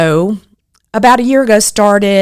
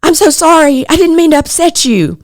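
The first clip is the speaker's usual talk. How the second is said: higher, flustered sorry voice; apologetic friend; 9 semitones higher than usual. Retold or imitated. Friend Kim apologizing at lunch, mildly flustered imitation. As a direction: lightly imitate a worried friend backing off. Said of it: "She said".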